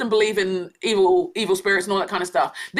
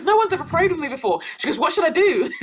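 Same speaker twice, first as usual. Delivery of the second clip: higher-pitched, eager retold voice; really excited; 6 semitones higher than usual. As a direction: imitate someone excited to be prayed for. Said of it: "she got really excited".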